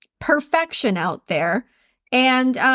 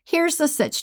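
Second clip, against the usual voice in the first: ordinary talk, nothing marked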